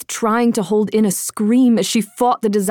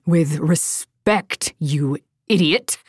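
slow, sarcastic, lower snap; drawn-out mocking "with respect"; snapping; 4 semitones lower, slower than usual. A narrator's reading. Darina mocks the soldier's words, slow and cutting. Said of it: "Darina snapped".

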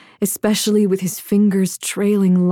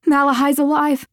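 high, urgent confession; blurted out; 7 semitones higher, 3 dB louder, faster than usual. A narrator's reading. Woman confessing to her lover before losing courage, blurted. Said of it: "She blurted the truth out".

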